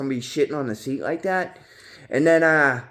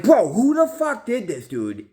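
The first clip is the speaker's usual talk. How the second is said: slow, high, loud mock outrage; squeaky retold demand; high outraged demand; 11 semitones higher, 4 dB louder, slower than usual. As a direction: drag it out in a high disgusted voice. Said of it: "I'm like".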